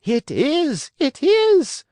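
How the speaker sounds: piercingly high, loud shriek; Signora Rosaura, shrieking. A narrator's reading. Puppet peeking from the side of the stage, shrieking. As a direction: shriek it at the very top of the voice. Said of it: "shrieked Signora Rosaura".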